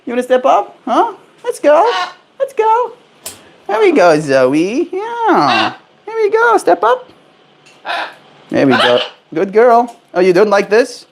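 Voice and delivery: high cooing pet voice; sing-song coaxing a parrot. Real, not acted. Owner coaxing the parrot Zoe up, high cooing.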